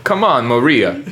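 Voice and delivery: lightly attempted Soviet accent; a hint of mock Russian accent. Shown as a demonstration. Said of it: "You do a Soviet accent".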